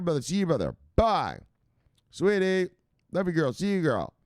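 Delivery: normal talking voice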